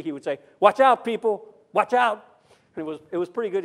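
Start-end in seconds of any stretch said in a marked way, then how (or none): 0.6-2.2 imitated French pastor calling out, high and loud; urgent warning with a light French accent. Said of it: "He'd say it with that French accent".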